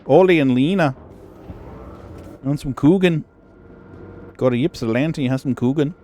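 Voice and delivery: mock up-north Minnesota folksy lilt; Minnesota accent. Joking with a friend about Midwest speech, hammed-up Minnesota accent. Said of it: "slight Minnesota twang to it".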